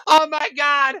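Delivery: high-pitched, breaking into real laughter; can't get the words out for laughing; laughing helplessly. Real, not acted. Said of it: "I can't stop laughing".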